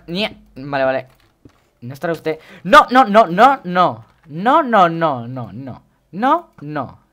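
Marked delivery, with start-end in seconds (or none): 2.6-7.0 real in-game alarm, voice jumping up; panicked shouting. Real, not acted. Panics during a game fight.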